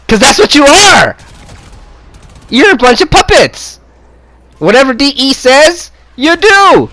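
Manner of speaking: real furious rant at viewers; angry. Real, not acted. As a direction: shout it at them in fury. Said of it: "Why do I sound angry?".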